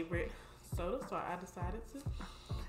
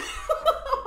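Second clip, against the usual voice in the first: breathy giggling burst; high unvoiced laugh; 11 dB louder than usual. Real, not acted. Laughs after burp.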